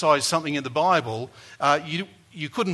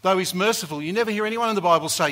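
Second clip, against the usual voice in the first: normal talking voice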